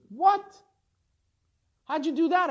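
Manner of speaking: loud, high disbelieving what; shocked. Acted. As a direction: blurt it out in shock. Shlomo shocked at the news.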